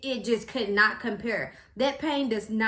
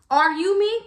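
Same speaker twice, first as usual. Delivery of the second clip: high, loud rhetorical question; indignant challenge; 7 semitones higher, 7 dB louder than usual. Retold or imitated. Patient challenging staff about pain, indignant loud challenge. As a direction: throw it back loudly, pitch raised.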